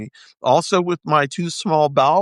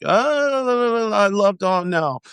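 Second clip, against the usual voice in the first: mocking fans; 7 semitones higher, much slower than usual. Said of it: "people were like".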